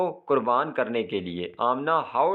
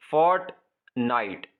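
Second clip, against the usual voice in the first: "Fortnite" in two separate beats; syllable by syllable; slower than usual. Shown as a demonstration. Teacher counting syllables, "Fortnite" split in two. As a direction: clear pause between fort and night in "Fortnite". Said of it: "दो syllable".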